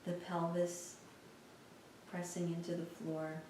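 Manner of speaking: soft, unhurried meditation voice with long pauses; guided meditation. Real, not acted. Teacher guiding listeners through a lying-down body scan, calm meditation voice. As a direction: guide them gently through a body scan, leaving space between phrases.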